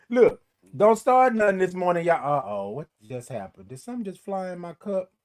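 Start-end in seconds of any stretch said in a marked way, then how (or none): none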